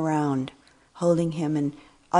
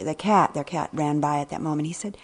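normal talking voice

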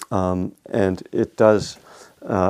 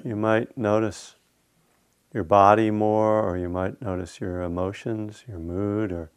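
guided meditation. Acted. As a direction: lead them unhurriedly into stillness, phrase by phrase.

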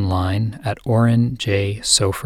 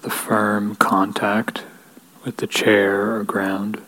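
guided meditation. Real, not acted. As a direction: lead them gently into stillness, unhurried, leaving space between phrases. Leads a guided equanimity meditation.

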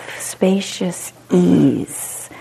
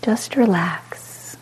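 calm, unhurried meditation guide with long pauses; short soothing phrases, falling gently into silence; guided meditation; 13 semitones higher, 5 dB quieter than usual. Real, not acted. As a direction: guide them into spacious ease, letting each phrase settle.

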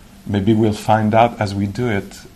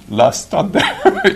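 laughing; 11 semitones higher, 4 dB louder, much slower than usual. Real, not acted. Jokes about wandering from the gate.

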